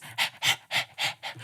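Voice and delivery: short, voiceless panting breaths; demonstrated panting. Shown as a demonstration. Guide dog showing the other dog how, panting. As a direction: show how to pant like a dog. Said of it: "you can pant, uh, like this".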